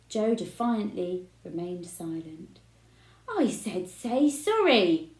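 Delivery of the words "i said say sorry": louder, slow insistent demand; shout. A narrator's reading. Father shouting at his son to apologise. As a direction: bark the order twice as hard. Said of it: "shouted Mister Spud".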